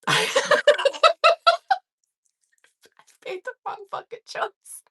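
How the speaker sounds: high, squeezed voice through laughter; real helpless laughter; laughing realization. Real, not acted. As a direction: repeat it while cracking up, barely voiced. Repeats punchline while cracking up.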